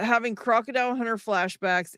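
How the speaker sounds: ordinary delivery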